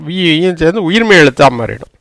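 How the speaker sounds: ordinary talk, nothing marked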